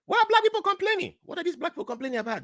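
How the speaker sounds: very high, puzzled protest; high-voiced, exasperated questioning; mock bewilderment. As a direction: act out baffled disapproval, pitched right up. Acting the puzzled newcomer's complaint.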